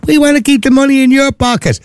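very high-pitched mocking mimicry; Simon Harris. Host mocking a politician's promise on income tax, high-pitched mimicry. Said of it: "that Simon Harris keeps talking about".

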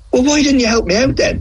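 high, exasperated retold plea; high mock-indignant retort; mock exasperation. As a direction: squeak it out in disbelief. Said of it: "And I'm like".

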